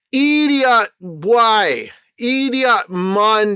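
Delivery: contemptuous slow jeer; scornful drawl. Real, not acted. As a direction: sneer the words out slowly. Mocking the two men.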